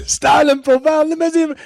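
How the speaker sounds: high, loud promo voice with a laugh; imitating Ric Flair. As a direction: strut through it like Ric Flair. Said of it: "the way he says".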